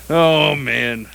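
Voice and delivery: ordinary talk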